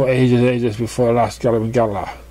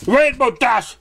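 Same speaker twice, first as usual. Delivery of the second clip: angry bellow; 13 semitones higher, slower than usual. Acted. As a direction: bellow at the wrecker. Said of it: "Twilight bellowed angrily".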